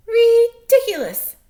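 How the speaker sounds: high, loud bird shout; chirping bird scorn. Acted. Bird scoffs.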